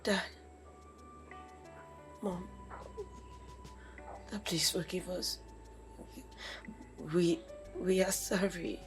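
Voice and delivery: soft tearful pleading. Acted. Daughter begs her father to forgive them. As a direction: beg them for mercy.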